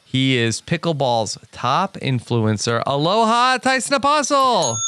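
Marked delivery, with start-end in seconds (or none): 2.9-4.8 excited welcome. Acted. Host introduces Hawaiian guest.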